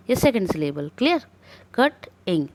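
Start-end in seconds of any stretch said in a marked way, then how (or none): 1.8-2.5 "कट, इंग" in two separate parts; split into syllables. Shown as a demonstration. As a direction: pause between कट and इंग. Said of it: "अलग-अलग part में होके".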